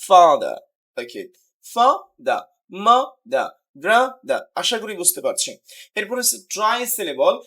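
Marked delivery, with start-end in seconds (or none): 0.1-0.6 father loud on the first syllable, falling away; two-syllable demo. Shown as a demonstration. Teacher showing students two syllables, demonstrative. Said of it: "দুইবার উচ্চারিত হয়েছে". 1.7-4.4 father, mother, brother each broken into two beats; syllable-by-syllable. Shown as a demonstration. Teacher splitting words for students, demonstrative. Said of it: "দুইবার উচ্চারিত হয়েছে".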